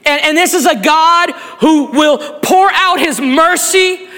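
building toward the altar call, fervent; preaching at full force. Acted.

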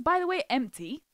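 usual speaking voice

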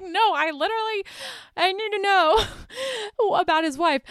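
high-pitched plea breaking into laughs; laughing mock-desperate plea. [name] insisting he needs to know about the wife, mock-desperate through laughter.

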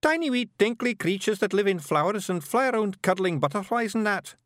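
high, singsong Scots description; tiny Scot explaining fairy nonsense; wee Scottish Feegle. A narrator's reading. Voices Awfully Wee Billy.

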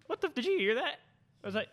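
ordinary talk

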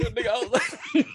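laughing as you talk; giggling admission. Real, not acted. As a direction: crack up mid-sentence. Laughing at friend's jab.

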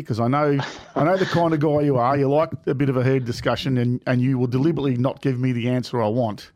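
ordinary talk

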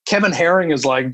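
usual speaking voice

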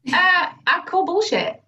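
high, slow mock call-out; teasing challenge. Coach calling out the client's dodge, slow and teasing. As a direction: call her bluff playfully.

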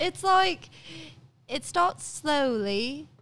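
wobbly put-on British accent; British accent drifting toward Australian. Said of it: "You fucked up my British accent".

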